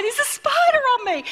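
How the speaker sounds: high, loud panicked cry; a child yelling that a spider is on her; retold scream. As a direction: shriek it like a terrified little girl. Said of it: "And I screamed".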